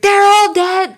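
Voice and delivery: high yell across the street. Retold or imitated. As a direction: call it out loud and high. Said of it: "she just yelled out".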